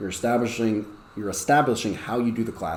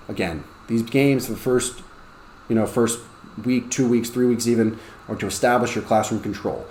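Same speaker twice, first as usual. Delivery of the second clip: ordinary talk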